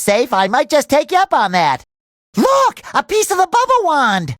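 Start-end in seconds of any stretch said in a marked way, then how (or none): 2.4-4.4 high, thrilled exclamation; excited discovery. Acted. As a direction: cry out high at the find. Spots a piece of the wand.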